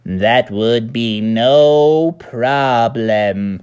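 slow, drawn-out alien voice. Acted. Dad doing E T for the kids, slow alien voice. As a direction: stretch every word like E T.

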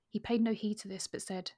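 ordinary talk, nothing marked

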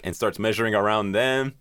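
normal talking voice

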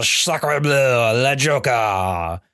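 put-on slow, drawn-out French accent; hammy French chef voice. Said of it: "leaned into the voice of the French chef".

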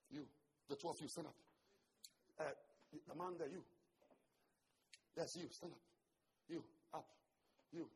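ordinary talk, nothing marked

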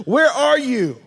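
God calling for his friends, loving not stern; longing call. Acted. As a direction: call out for someone you miss. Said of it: "not calling them with a tone of condemnation".

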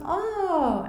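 high infant call; baby coo. Retold or imitated. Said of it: "So the baby goes".

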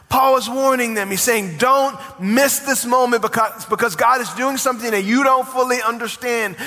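preaching at full force. Acted. Pastor stirring his congregation, preaching at full force. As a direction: preach it big and rising to the crowd.

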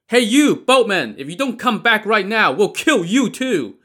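loud threatening yell; shouted threat. A narrator's reading. Men on land threatening the boatman, shouting. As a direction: roar it from the shore. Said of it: "the men on land shouted".

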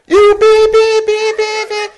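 very high loud bleeps for censored swearing; imitated horn beeps. Censored cursing at driver.

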